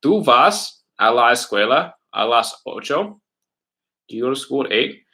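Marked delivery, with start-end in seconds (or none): 0.0-3.2 slow, rising Spanish question. Shown as a demonstration. Teacher slowly asks the class the Spanish question. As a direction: ask it slowly with a lift at the end.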